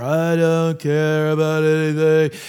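low, slow gloomy donkey drone; listless, droning impression of a mopey character; Eeyore. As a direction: drag it out low, past caring. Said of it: "It's like Eeyore".

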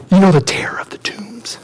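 hushed, breath-only secret; spooked whisper. Acted. Shepherds telling townspeople about the madman, spooked whisper. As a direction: whisper it as a dreaded name.

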